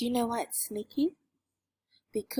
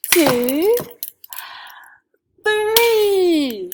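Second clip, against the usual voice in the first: loud, high, rising class count; excited counting; 3 semitones higher, 8 dB louder, much slower than usual. Acted. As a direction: count claps big and bright.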